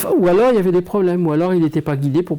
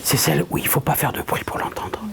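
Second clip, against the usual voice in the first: soft unvoiced whisper showing the little voice; 7 dB quieter than usual. Shown as a demonstration. Medium showing the audience how the little voice speaks, whispering. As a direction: whisper it like the inner voice. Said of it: "qui vous parle comme ça, doucement".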